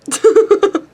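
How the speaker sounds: squeaky giggle; a high breathless burst of laughter; laughing at herself. Real, not acted. Laughing at own story.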